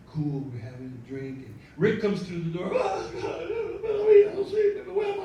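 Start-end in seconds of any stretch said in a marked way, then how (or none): 2.7-5.2 high-pitched mock despair; distraught wailing. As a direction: wail it high, like a man falling apart. Rick arrives distraught, out of cocaine.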